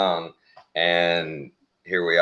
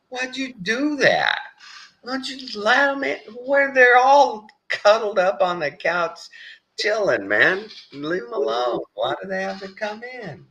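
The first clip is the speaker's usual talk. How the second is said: mock wife. Husband mimicking wife's worry over the dogs, high fussy voice. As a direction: ham up her fretting.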